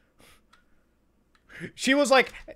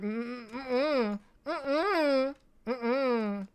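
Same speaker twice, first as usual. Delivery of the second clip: disapproving hums; 8 semitones higher, much slower than usual. Acted.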